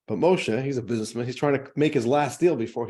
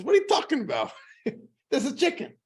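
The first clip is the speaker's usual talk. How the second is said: exasperated priest; 13 semitones higher than usual. Acted. Priest arguing fish or chicken.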